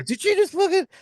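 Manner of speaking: shocked. Retold or imitated.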